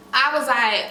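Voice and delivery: ordinary talk, nothing marked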